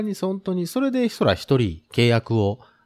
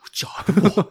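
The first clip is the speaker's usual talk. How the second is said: whisper; 8 semitones higher than usual. Pretend. 新宿の通行人が独り言でささやく. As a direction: murmur it as if nobody should hear. Imagined onlooker muttering to himself.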